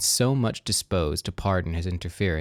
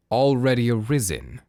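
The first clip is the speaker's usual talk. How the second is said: louder, incredulous echo of her word; mock surprise; 3 semitones higher, 5 dB louder than usual. A narrator's reading. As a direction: throw her words back in amused disbelief. Father repeats her words.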